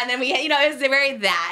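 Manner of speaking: normal talking voice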